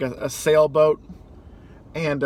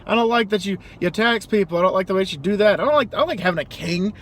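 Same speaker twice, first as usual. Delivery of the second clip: hammed-up fed-up colonist quitting; mock defiant colonist; 6 semitones higher than usual. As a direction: squeak out a huffy exit. Acted colonists quitting England.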